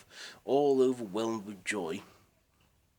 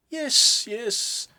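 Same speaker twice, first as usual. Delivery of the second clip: much higher and louder joyful yes; 12 dB louder than usual. Acted. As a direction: burst out with glee. Scrooge confirms raise joyfully.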